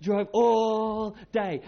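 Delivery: "all day" said slowly and heavily, word by word; drawn-out all day. Shown as a demonstration. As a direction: drag out "drove all day" to feel endless. Emphasizing the long daily drive.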